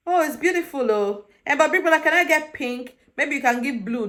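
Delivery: high-pitched imitation of a picky housemate; mock gushing. Housemate asking Big Brother for pink, mock gushing. Voicing housemate reacting to the outfit.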